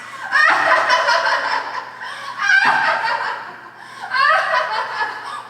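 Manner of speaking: a high, loud burst of laughter; shrill mocking laugh. Indignant woman laughing loudly at the bank manager.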